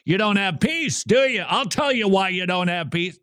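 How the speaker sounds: mock condemning sermon with no love in it; harsh scolding. Shown as a demonstration. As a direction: wag a finger and scold them. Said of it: "Rather than".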